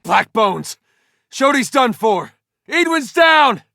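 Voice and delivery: high-pitched alarm calls; urgent battle shout. Acted. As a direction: shout casualties over the fighting. Reporting casualties mid-battle.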